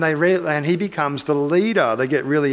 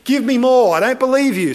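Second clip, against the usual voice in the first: louder, higher acted doubt; raised-voice mock insistence; insistent demand; 7 semitones higher, 5 dB louder than usual. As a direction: push back as a sceptical Gideon. Gideon acted demanding more proof.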